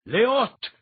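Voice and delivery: loud, high shouted command; commanding spell word. A narrator's reading. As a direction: call up a wall of light.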